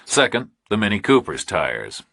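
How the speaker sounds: usual speaking voice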